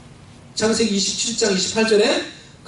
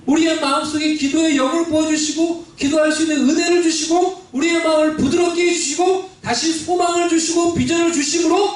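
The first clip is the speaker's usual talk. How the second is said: lifted, fervent sermon delivery; climbing preacher's cadence; raised preaching voice; 7 semitones higher than usual. Acted. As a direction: preach it up toward the congregation. Preaching build-up.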